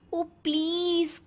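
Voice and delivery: mocking a cheap shirt with a haughty drawl; scornful drawl. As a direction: drag out the please in disdain. Bride scoffs at cheap t-shirt.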